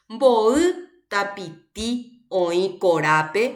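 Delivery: MBO'Y TAPITI OĨ KORÁPE very slow, stressed syllables stretched; stressed syllable demonstration. Shown as a demonstration. Teacher reads for students to hear accents, slow stressed demonstration. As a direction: read word by word, lengthening each accent. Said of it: "Dónde pronuncio con mayor intensidad".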